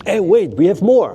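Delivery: high, excited TV-ad pitch; infomercial pitchman. Retold or imitated. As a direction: sell it like a late-night infomercial. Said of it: "you literally sound like the infomercial guys".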